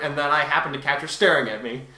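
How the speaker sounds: normal talking voice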